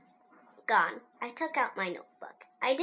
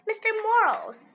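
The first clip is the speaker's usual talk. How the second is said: high, louder name reveal; grand announcement; 11 semitones higher, 7 dB louder than usual. A narrator's reading.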